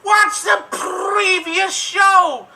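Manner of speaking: high, loud, frantic cartoon rabbit voice; Roger Rabbit. Improviser shouting as Roger Rabbit to audience, high manic cartoon voice. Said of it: "I'm Roger Rabbit!".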